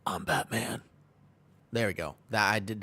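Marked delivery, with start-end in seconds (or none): 0.1-0.8 second, softer take on a superhero voice; Batman. Acted. Streamer retrying his superhero impression for chat, put-on voice. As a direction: put on the masked hero voice, softly. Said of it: "did I do it better now? Was that better?".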